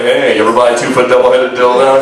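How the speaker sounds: low, deadpan pickup voice; a guy opening with a bad line, low and flat; cheesy pickup line. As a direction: hit on her with a straight face. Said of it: "you're like".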